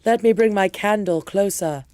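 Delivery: lightly hammed-up British storyteller; mock British, starting loud and settling down; put-on British accent. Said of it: "I have to do my accent".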